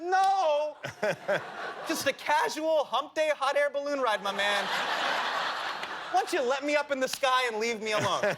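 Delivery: high, sarcastic cool-guy voice; mock high voice. Speaker mocks himself in story.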